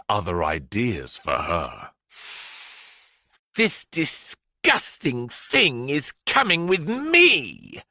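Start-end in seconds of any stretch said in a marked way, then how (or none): 3.6-7.8 slow, high bellow of disgust. A narrator's reading. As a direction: boom it at the children. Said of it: "bellowed the headmistress".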